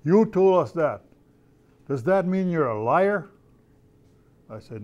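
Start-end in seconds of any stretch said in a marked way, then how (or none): 0.0-3.3 a hint of a challenging kid, higher and louder; young people challenging. Elder quoting young people who accuse him. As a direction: lightly act a kid calling out a liar. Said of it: "come back on you someday and say".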